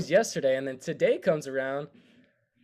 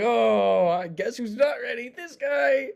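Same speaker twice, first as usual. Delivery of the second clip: shouted, pitched-up self-mocking disbelief; loud high disbelief; 8 semitones higher, 4 dB louder, slower than usual. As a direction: yell it high like you can't believe it. Retold shock at a surprise midterm.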